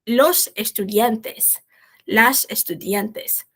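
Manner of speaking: each plural said very slowly and clearly; slow model reading. Shown as a demonstration. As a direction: spell out los estudiantes and las estudiantes slowly for the class. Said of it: "teacher models plural forms slowly". (leitura lenta e clara)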